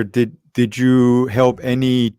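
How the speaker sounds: ordinary talk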